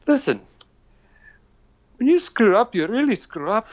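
high, emphatic grandpa imitation; mock scolding in grandpa's high voice; grandfather's voice. Grandfather telling off grandson who ran out of beer, imitated high voice. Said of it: "he just kinda paused for a minute".

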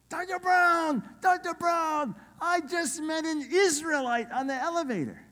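very high, stretched-out excited calling; imitated excited translator. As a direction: burst out with amazing news, imitating the translator. Excited translator phoning with news.

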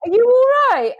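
high, rising panicked questions; worried witch colleague asks what's wrong; alarmed colleague. Retold or imitated. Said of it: "colleague who's the wicked witch is like".

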